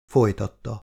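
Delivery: ordinary delivery, nothing marked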